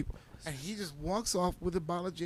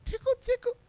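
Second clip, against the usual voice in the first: much higher sing-song teasing voice; teasing tickle. Retold or imitated. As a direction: tease him like tickling a baby. Stranger teasing sleeping friend.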